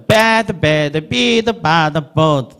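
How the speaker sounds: Bad, bad, bid, bad, bird in a rhythmic row, stretching each vowel; chanted classroom run of Bad, bad, bid, bad, bird; vowel drill. Shown as a demonstration. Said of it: "이렇게 훈련시켜주는 거예요".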